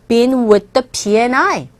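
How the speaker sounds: slow, clear model question; slow demo question. Shown as a demonstration. Teacher posing a model question slowly. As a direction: ask it slowly for learners. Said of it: "제가 지금 질문 드렸죠".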